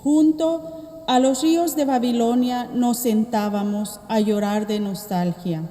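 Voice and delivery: ordinary delivery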